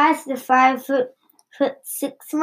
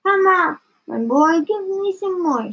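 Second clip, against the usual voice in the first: higher-pitched demanding grandma; mild yell; 6 semitones higher than usual. A narrator's reading. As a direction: lightly yell for more, pitched up. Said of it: "she yelled".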